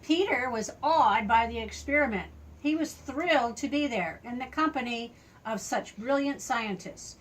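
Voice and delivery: ordinary delivery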